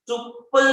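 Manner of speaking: teacher hitting pull for emphasis; stress on pull. Shown as a demonstration. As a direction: pull punched high and loud. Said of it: "मैंने word पे जोर दिया".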